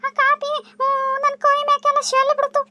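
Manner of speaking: put-on high, whimpering girl's voice; high hurting voice explaining the burn; pained young woman. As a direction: tell him through the hurt, small and high. Vasu explains burn, in pain.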